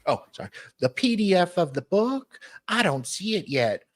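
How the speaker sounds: ordinary talk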